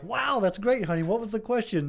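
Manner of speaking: a touch of a proud mother; impressed mom. Acted. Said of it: "mom's like".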